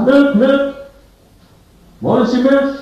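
high, loud singsong milkmaid's cry; milkmaid calling out. Acted. Preacher plays the milkmaid offering milk to Death, high loud call. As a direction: call out wares like a market milkmaid. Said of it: "da kommt eine Milchmädchen".

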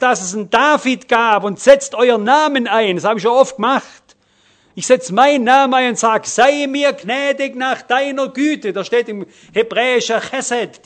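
loud, driving sermon voice; rising pulpit fervour, calling out to the congregation; fervent preaching. Acted. Preaching at full force.

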